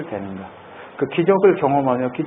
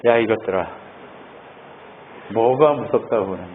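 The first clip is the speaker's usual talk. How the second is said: lower, unhurried calm rebuke; calm and composed; 5 semitones lower, 4 dB quieter than usual. Acted. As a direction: speak with serene composure. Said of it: "너무 처연하게 일어나서". (예수님이 제자들에게 처연하고 담담하게)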